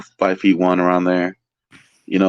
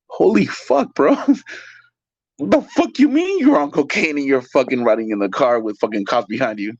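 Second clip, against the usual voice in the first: high shocked outburst, dropping off at the end; reacting to a crazy confession; stunned disbelief; 5 semitones higher than usual. Reacting to the cook who fled police on cocaine, shocked.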